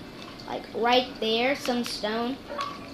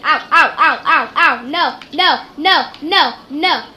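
getting a leg cramp; 3 semitones higher, 7 dB louder than usual. Real, not acted. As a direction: yelp through a painful cramp. Pain cries while playing.

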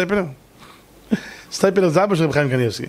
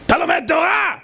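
Steipler's shout; 11 semitones higher than usual. Retold or imitated. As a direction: yell it like a stern old rabbi. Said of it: "נתן לו צעקה".

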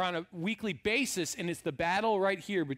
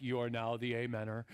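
mock solemn; 10 semitones lower, 7 dB quieter than usual. Mock solemn passing of role.

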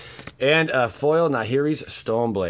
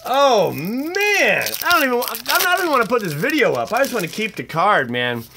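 much higher, louder and fast; jealous excitement; 10 semitones higher, 5 dB louder than usual. Real, not acted. Wanting to keep the friend's card, high and rushed. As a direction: rush it out in giddy envy.

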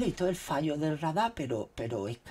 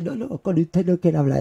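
louder mock caller brush-off; imitating caller; 6 semitones higher, 15 dB louder than usual. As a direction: ham up the rude caller. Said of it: "Y dice".

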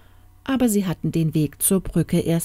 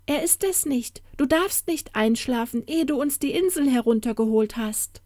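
put-on high-pitched child giant urging father; pitched-up, insistent youngster; little giant's voice; 7 semitones higher than usual. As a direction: warn father as his little one. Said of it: "rief einer der kleinen Seeriesen".

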